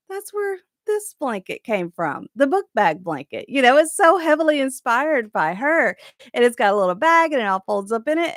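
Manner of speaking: ordinary talk, nothing marked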